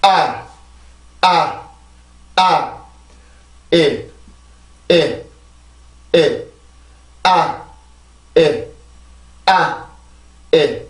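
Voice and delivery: 아 and 에 as separate beats, evenly spaced; vowel rhythm drill. Shown as a demonstration. Teacher beats out the word rhythm on vowels only. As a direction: chant 아 and 에 slowly to show the rhythm. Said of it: "자, 해볼게요".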